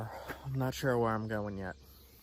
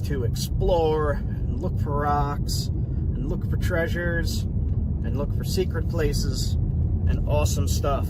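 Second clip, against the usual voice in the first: energetic intro; 3 semitones higher, 10 dB louder than usual. Acted. Loud opening intro to camera.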